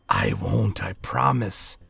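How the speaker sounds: hushed-toned eerie promise; icy voice. A narrator's reading. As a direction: reassure the child coolly. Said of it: "an icy voice".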